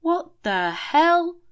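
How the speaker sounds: high, loud, slow shocked outburst; stunned, drawn-out exclamation; shocked cry. A narrator's reading. Officer bursting in on the light show, shocked and loud. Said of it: "cried Officer Lewis Denny".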